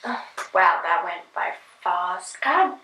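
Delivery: usual speaking voice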